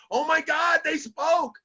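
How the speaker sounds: high, shouted mock delight; excited parent. Parents hearing their baby's first word, excited shout. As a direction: burst out like a thrilled parent. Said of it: "you go".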